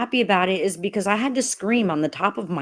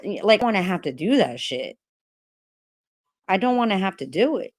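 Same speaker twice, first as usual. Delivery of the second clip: ordinary talk, nothing marked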